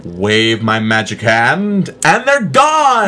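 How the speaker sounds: slow build to a high, loud reveal; stage magician. Acted. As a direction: draw out the patter, then a big flourish. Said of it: "turns it into a stage magic trick".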